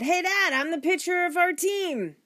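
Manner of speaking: put-on high, loud boy butting in; eager interruption. A narrator's reading. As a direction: burst in boasting to Dad. Boy butts in at dinner.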